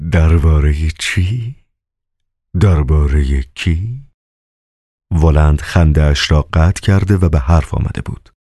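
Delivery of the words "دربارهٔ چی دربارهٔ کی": slow, drawn-out questions; Woland, lightly voiced. Woland questioning the master, slow and drawn out. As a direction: stretch each question out slowly.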